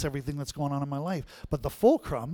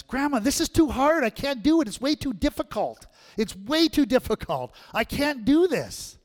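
put-on much higher, louder frustrated little girl; whiny child yelling; 10 semitones higher, 6 dB louder than usual. Granddaughter calling from the living room, loud high whine. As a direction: whine and call out like a fed-up kid.

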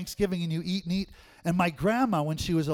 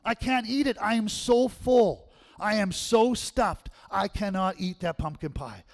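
higher, slow, drawn-out mock pleading; overstuffed plea; 5 semitones higher, 4 dB louder than usual. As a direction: beg off slowly, too stuffed to move. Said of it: "I said".

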